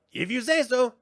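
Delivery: high, dry little sprite voice; skeptical brownie. Acted. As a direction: toss it off doubtfully. Brownie doubts Willow.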